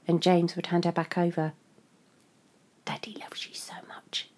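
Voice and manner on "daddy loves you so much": whispered. Acted. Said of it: "he insisted".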